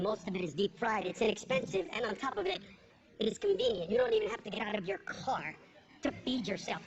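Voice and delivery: a hint of a pompous TV guest explaining himself; low, earnest talking-head rant; mild mock lecture on fast food; lightly voiced obese pundit. Comic voicing the obese guest.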